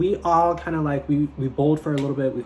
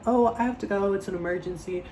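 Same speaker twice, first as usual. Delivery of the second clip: higher, sing-song fake emergency; higher breezy excuse; fake urgent excuse; 6 semitones higher than usual. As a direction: sell a made-up emergency. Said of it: "I was like".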